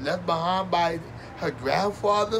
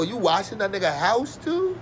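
ordinary talk, nothing marked

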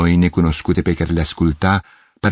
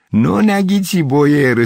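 high-pitched, gleeful call; excited cry; 8 semitones higher, slower than usual. A narrator's reading. Red-haired girl taunting the man who failed, excited cry. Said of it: "strigă el".

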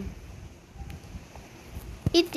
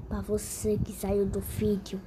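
ordinary talk

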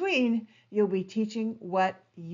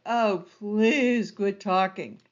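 slow, weary, drawn-out plea; bored plea; 4 dB louder, slower than usual. Retold or imitated. Bored viewer pleading with a droning presenter, weary and slow. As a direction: sigh it out like a bored webinar viewer. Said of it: "you're just like".

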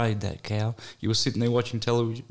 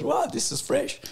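pitched much higher, playful mock pitch; mock salesman. Mimicking the prankster showing the piglet, high and playful. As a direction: sell the freshness in a high put-on voice. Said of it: "and he goes".

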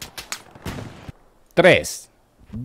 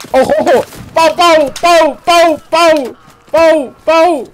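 high, loud shouted pau-pau; mock gunshots; 12 dB louder, much slower than usual. Streamer voicing the gunfire on screen, loud mock gunshots. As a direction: blast out the shots like a kid playing war. Mimicking gunfire while watching.

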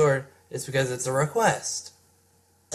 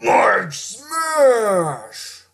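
high, loud war cry; battle shout; 6 semitones higher, 4 dB louder than usual. Acted. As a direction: roar it as you strike. Hero attacking the villain.

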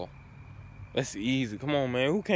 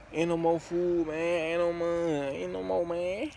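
normal talking voice

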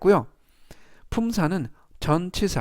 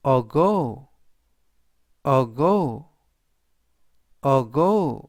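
ago with a strong, long GO; stress on final O; much slower than usual. Shown as a demonstration. Teacher modelling ago for learners, stress on the final O. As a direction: say ago three times, punching the final O. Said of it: "뒷부분에 있는 O의 강세가 있습니다".

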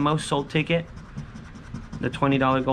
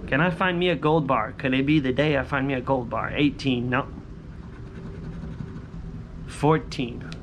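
ordinary talk

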